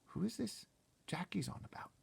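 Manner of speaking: plain reading voice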